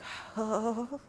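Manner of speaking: long, soft mock sigh of boredom; imitated bored sigh. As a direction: sigh like a fed-up audience. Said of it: "You guys were like".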